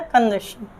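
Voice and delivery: "कंजक्शन" said kan-jek-shan, dropping low; students' mispronunciation. Shown as a demonstration. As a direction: jek instead of junk in "कंजक्शन". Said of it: "इसको बोलता है विद्यार्थी". ("कंजक्शन" को कन-जेक्शन, धीमे स्वर में)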